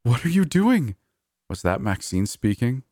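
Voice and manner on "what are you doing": high, loud frightened shout; frightened cry. Acted. Coworker crying out in fear at Leia, frightened shout. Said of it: "started to sound really frightened".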